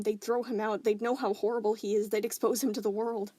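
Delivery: usual speaking voice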